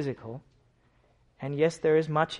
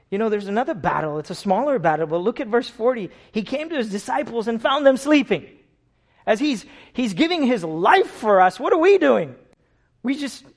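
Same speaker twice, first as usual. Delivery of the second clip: full-force preaching; 7 semitones higher, 9 dB louder than usual. Acted.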